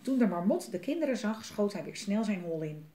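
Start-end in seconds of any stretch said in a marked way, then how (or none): none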